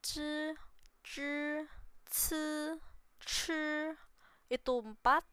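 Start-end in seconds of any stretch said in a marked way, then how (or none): 0.0-3.7 ci said with shifting tones; Mandarin tones on ci. Shown as a demonstration. Teacher drilling the tones of ci for viewers. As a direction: sound out ci, ci, ci in different tones. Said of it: "yang paling sering kebalik itu".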